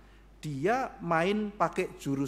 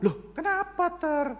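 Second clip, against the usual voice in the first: mock king; 11 semitones higher, slower than usual. King voiced mockingly.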